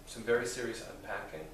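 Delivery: normal talking voice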